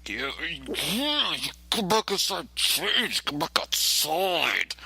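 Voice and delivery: high, slow put-on cop; mocking the officer's voice; silly cop voice. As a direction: drag out the cop's question in a goofy voice. Cop permits changing clothes.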